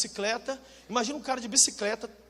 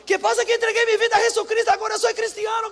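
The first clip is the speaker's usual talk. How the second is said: high, loud and jubilant with a Spanish lilt; joyful Carlos; 12 semitones higher, 8 dB louder than usual. Retold or imitated. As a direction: shout it out like a happy new convert. Carlos joyfully announces conversion.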